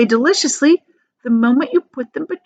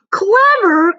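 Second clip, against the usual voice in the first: high, loud scoff of disbelief; incredulous cry; 11 semitones higher, 6 dB louder than usual. Acted. Grandpa Joe crying out at Charlie, high and loud. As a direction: spit the word back astonished. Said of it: "cried the old man".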